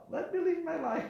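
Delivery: very high, exasperated protest; high mock complaint; imitated plea. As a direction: act out a man wanting to be left alone. Voices the man's plea, amused.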